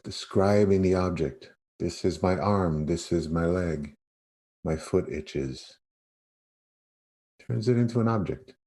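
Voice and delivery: ordinary talk, nothing marked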